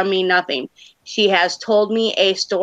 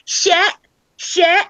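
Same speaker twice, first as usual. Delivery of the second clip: very high little-kid imitation; high, short mimicked child calls; excited toddler; 12 semitones higher, 3 dB louder than usual. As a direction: squeal it like a toddler spotting boats. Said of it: "two and a half year old was over here going".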